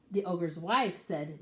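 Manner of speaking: plain reading voice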